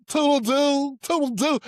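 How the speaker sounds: very high, mock angry toodle-doo; mad toodle-doo. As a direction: snap toodle-doo like she's fuming. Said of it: "and mad".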